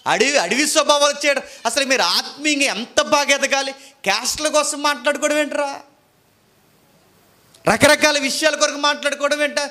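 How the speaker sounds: high, loud rebuking sermon; fiery preacher scolding his church; preaching at full force. Acted. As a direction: scold the congregation from the pulpit.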